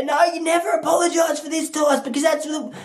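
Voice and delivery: high, strained mock protest; insistent follower. Follower warns Tice never to apologise, mock-insistent. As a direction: urgently argue them out of it.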